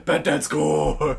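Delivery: slow, drawn-out rasping cries; Bat Dad. Acted. Friend narrates his play, Bat Dad voice. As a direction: draw out each hero cry. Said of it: "I was doing Bat Dad".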